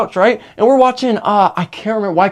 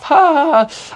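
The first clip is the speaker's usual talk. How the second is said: higher mock fake laugh, each ha separate; forced laugh; 7 semitones higher, slower than usual. Teller mimics little sister faking a laugh, a higher mock ha ha. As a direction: laugh on cue without meaning it. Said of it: "I feel her force a laugh".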